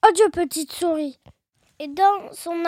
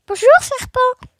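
salut lancé; 5 semitones higher, 5 dB louder than usual. Acted. Mouse hails the snake.

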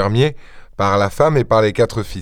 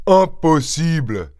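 higher, drawn-out protest; exclamation; 5 semitones higher, slower than usual. A narrator's reading. Farmer rejecting the question, exclaiming. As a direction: cry it out slowly, without shouting. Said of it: "s'écria le fermier".

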